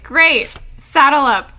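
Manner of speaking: rally them to ride out; eager call. Retold or imitated. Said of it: "And they're like".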